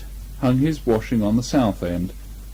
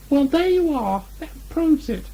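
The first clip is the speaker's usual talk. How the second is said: much higher small Piglet voice. A narrator's reading. Piglet triumphant to Christopher Robin, high and small. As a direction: squeak it out like a tiny piglet.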